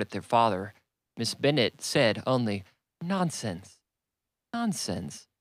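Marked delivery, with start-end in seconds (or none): 3.0-5.3 dismissive exclamation, starting high and falling; emphatic exclamation. A narrator's reading. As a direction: dismiss it emphatically without shouting. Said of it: "that emphatic exclamation".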